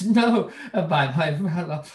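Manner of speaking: laughing through the words. Acted. Akers answering Sir Lucius, laughing as he speaks. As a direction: let a laugh break into it.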